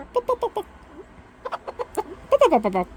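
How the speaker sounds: high bop bop call to a hen; chicken call. Acted. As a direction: cluck it out like calling chickens. Calling to the pet chicken.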